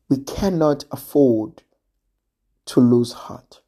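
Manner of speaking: whispered, low and intimate. Real, not acted. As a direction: breathe the words out to the listener. Intimate sermon climax.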